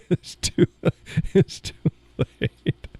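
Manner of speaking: wheezing, voiceless laughter between words; words broken up by helpless laughter; laughing. Real, not acted. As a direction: try to finish while cracking up. Laughing too hard to finish.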